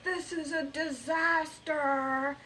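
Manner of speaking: shout. A narrator's reading. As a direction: shout it like the sky is falling. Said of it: "And Jed shouted".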